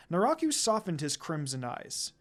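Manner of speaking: usual speaking voice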